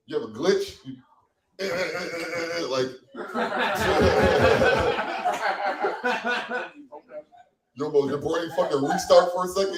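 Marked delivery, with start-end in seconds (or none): 2.7-7.2 stuttered, drawn-out malfunction sound; glitching stutter noise. Acted. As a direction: imitate a brain short-circuiting. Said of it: "it's called the glitch".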